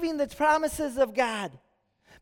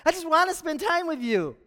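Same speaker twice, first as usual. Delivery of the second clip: high, laughing call that builds into a louder plea; amused disbelief, pitched up and broken by a laugh; cracking up; 4 semitones higher, 3 dB louder than usual. Acted. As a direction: crack up on the name, then press on amused. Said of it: "I can just imagine Jesus cracking up".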